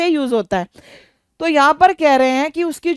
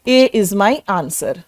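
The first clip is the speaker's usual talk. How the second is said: slow dictation; 5 semitones lower, much slower than usual. Shown as a demonstration. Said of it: "तो हमारा answer क्या हुआ".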